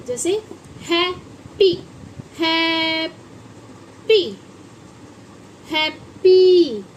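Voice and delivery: slow, drawn-out हैप्पी for pupils; teacher modelling a word. Shown as a demonstration. Teacher to pupils, slow drawn-out demo word. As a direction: stretch हैप्पी out slowly so kids hear each sound. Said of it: "H A double P Y".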